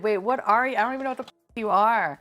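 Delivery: ordinary delivery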